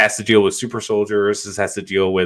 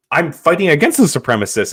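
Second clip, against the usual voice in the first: indignant Carly; 7 semitones higher, 4 dB louder than usual. Retold or imitated. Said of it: "and she's like".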